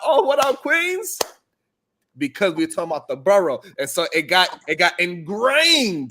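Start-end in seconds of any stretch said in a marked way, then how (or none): 0.0-1.2 party greeting. Retold or imitated. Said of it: "when we go to the party".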